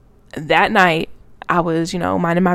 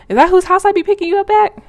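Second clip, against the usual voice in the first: high, loud mock-innocent question; pointed questioning; 12 semitones higher, 6 dB louder than usual. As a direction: pitch it way up as you confront him. Retold confrontation with her boyfriend.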